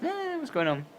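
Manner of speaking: mock-panicked asking what's going on. Retold panic at interrogation.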